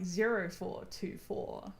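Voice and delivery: slow, deliberate digit-by-digit reading; pedantically measured, every digit separate; pedantic. Shown as a demonstration. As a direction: spell out the phone number slowly and pointedly. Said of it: "which is very, uh, pedantic of me".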